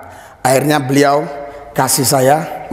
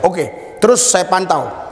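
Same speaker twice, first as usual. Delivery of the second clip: ordinary talk, nothing marked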